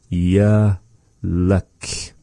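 "Yaluk" in two slow, separated syllables. Shown as a demonstration. Teacher sounding out the next word for learners, "Yaluk" syllable by syllable. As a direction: draw out "Yaluk" with a pause in the middle.

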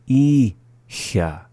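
"Icha" split, long "I", pause, then "cha"; slowly. Shown as a demonstration. Teacher repeating after the English, "Icha" said slowly in syllables. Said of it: "the second time slowly".

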